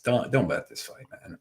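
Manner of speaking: usual speaking voice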